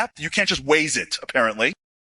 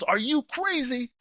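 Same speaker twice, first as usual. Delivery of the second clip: exasperated scold; 8 semitones higher, 5 dB quieter, slower than usual. Retold or imitated. Officer scolds injured soldier.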